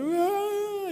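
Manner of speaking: high-pitched mock wail; imitated crying. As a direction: bawl like a kid running in hurt. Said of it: "eles chegam chorando". (choro de criança imitado, bem agudo)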